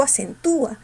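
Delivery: ACENTÚA with a long strong U; stressed U. Shown as a demonstration. Teacher comparing true hiatuses, stressed U in acentúa. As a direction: stretch "acentúa" as a-cen-TÚ-a. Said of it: "La fuerza no está en la U".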